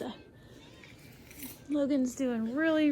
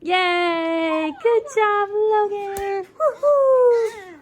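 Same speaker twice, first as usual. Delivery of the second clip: excited cheering; 7 semitones higher, 8 dB louder, much slower than usual. Real, not acted. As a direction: cheer him on with big enthusiasm. Cheering child finishing counting.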